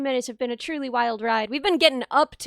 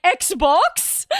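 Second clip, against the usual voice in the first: incredulous funny-voiced "Xbox?", rising at the end; mock the professor's disbelief, pitched up; hammed-up retold bafflement; imitating baffled professor; 4 semitones higher, 4 dB louder than usual. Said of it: "how to say Xbox in a funny voice".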